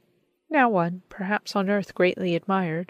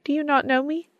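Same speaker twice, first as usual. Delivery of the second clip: calling out; 8 semitones higher than usual. Acted. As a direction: lift it high and call, a playful question. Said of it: "she called as she drew near".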